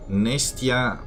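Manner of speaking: normal talking voice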